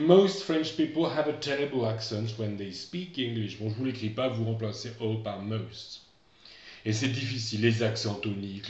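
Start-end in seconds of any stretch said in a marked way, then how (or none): 0.1-3.6 dictation voice. Shown as a demonstration. Teacher dictating corrected sentence.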